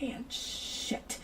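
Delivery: soft, breathless whisper of a curse. Retold or imitated. Recalling her grief over a late friend, in a soft whisper. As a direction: let it slip out under your breath. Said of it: "It's just like".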